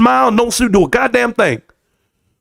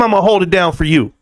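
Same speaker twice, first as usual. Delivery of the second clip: ordinary talk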